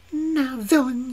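slow, high witch's cry of triumph; witch maiden crying out. A narrator's reading. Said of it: "cried the witch maiden".

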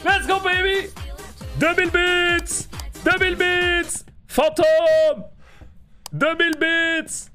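slow, high stretched-out yelling; hype shout. Real, not acted. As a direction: belt it out like a hype man. Hurle en remerciant un don. (cri d'euphorie étiré)

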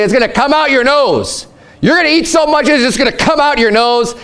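high, emphatic teasing; exaggerated mock God. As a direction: push it up high like a scolding parent.

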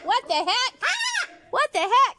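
very high loud what the heck; high loud surprised cry; high startled exclaim. Real, not acted. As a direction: squeal it in surprise. Startled by the bird.

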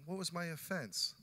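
meek, slow mock of a mild question; mock mild Jacob. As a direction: far too tame, drawn out for mockery. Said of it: "I don't think Jacob was like".

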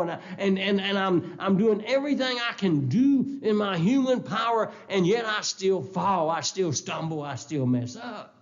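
frustrated prayer. Retold or imitated. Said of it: "I sometimes say".

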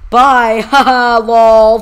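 very loud, high gloating yell with a laugh; full-force victory shout; triumphant taunt. Real, not acted. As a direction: crow and laugh at the losers. Gloats over winning fight.

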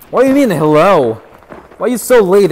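loud, indignant telling-off; mock scolding. Streamer to his late moderator, mock scolding. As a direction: raise your voice at the latecomer.

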